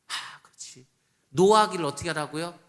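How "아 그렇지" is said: whispered aside. Acted. Self-answer aside.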